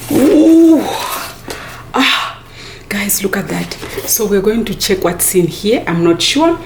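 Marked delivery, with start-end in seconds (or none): 0.1-0.8 high, louder ooh of delight. Real, not acted. Vlogger marveling at the new drone, delighted high ooh. 1.9-3.7 softer whispered look at that; awed whisper. Real, not acted. Vlogger showing viewers the drone, awed whisper.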